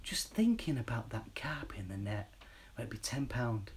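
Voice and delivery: hushed soothing whisper; sleepy whisper. Pretend. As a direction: lull them with whispers. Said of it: "Just go to sleep".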